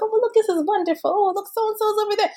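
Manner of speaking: high-pitched, quick mock marvelling; imagined leisurely admiring. Event host imagining sitting back to admire the crowd, high mock wonder. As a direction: gush airily as if taking it all in.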